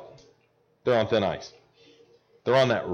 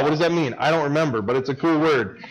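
ordinary talk

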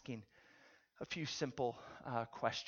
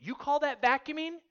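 high, loud mock outrage; acted disbelief, pitched way up; scornful wife; 11 semitones higher, 11 dB louder than usual. Preacher replays wife's jab at husband, scornful.

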